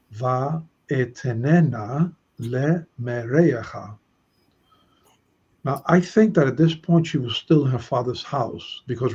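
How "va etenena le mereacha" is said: slow Hebrew "va etenena le mereacha"; teacher reading the Hebrew text to students, slow. Shown as a demonstration. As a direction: read the Hebrew phrase slowly and carefully. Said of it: "Therefore I gave her".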